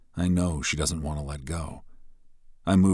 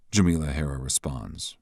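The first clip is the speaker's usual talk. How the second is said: normal talking voice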